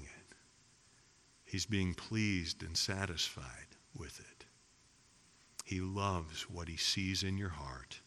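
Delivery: very soft, low, reverent delivery with long pauses; hushed, savoring preaching. Real, not acted. Preacher telling givers God enjoys their heart, hushed and tender. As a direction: let it sink almost to a whisper, savoring each phrase.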